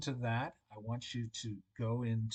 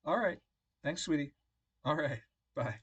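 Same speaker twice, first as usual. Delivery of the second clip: higher, louder phone sign-off; warm goodbye; 5 semitones higher, 6 dB louder than usual. Real, not acted. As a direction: wrap up the call sweetly. Ending the phone call.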